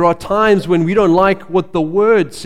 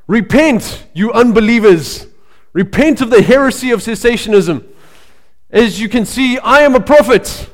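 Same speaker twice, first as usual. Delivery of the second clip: higher, louder booming Scottish prophet voice; intimidating Scottish accent; 6 semitones higher, 5 dB louder than usual. Retold or imitated. Preacher mimics Scottish prophet rebuking the church, booming Scottish accent. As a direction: boom it out like a fiery Scottish prophet. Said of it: "in a very intimidating Scottish accent".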